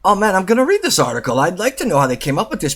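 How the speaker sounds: fast, high eager voice; excited quote. Retold or imitated. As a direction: gush it out quickly. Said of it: "and I was like".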